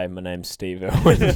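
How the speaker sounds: broad Australian accent, lightly put on; low-pitched hammed-up Australian introduction; Steve Irwin. Host introducing himself as crocodile man, broad Australian accent. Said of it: "Australian accent".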